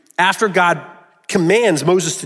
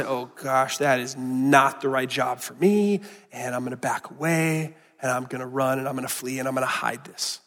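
soft, hushed voicing of a doubter; quiet fearful voicing; 5 semitones lower, 8 dB quieter than usual. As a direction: murmur the excuses softly, shrinking back. Voicing Moses' fearful self-doubt.

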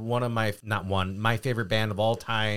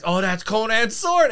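excited; 9 semitones higher, 5 dB louder than usual. Retold or imitated. Telling kids about the sword.

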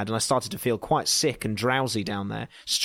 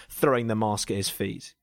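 plain reading voice

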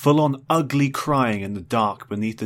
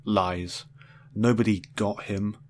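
usual speaking voice

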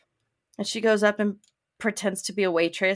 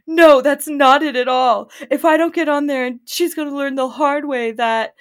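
loud, raised-pitch lovesick pleading; impassioned protest; 6 semitones higher, 7 dB louder than usual. Retold or imitated. Lovesick man begging a ticket clerk, mock-impassioned. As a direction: plead your case with force.

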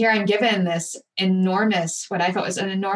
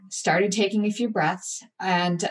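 ordinary delivery

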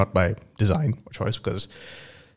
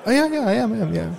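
Anna's voice; 12 semitones higher, faster than usual. Acted. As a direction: play shy Anna, pitched up. Acted dialogue, Anna voice.

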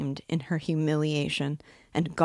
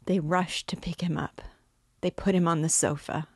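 usual speaking voice

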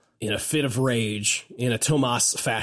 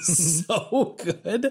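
laughing; 6 semitones higher than usual. Real, not acted. Gushing about Monica.

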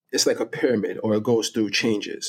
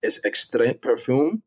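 ordinary talk, nothing marked

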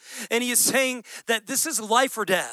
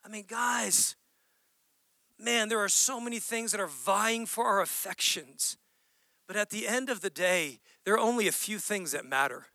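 much softer, intimate close; hushed earnest; 2 semitones lower, 6 dB quieter than usual. Real, not acted. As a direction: drop to a near-hush to draw them in. Pastor turns intimate and soft.

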